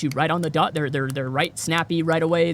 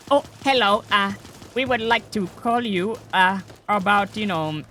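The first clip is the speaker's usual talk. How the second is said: hammed-up scam recruiter reading lines; scam caller; 4 semitones higher than usual. Mocking a scam recruiter calling him, halting and unsure. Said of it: "they sound off".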